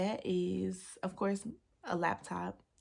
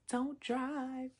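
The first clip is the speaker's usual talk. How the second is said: higher-pitched imitation of grandma; 5 semitones higher than usual. Granddaughter quotes grandma in the car, imitated grandma voice. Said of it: "My grandma was like".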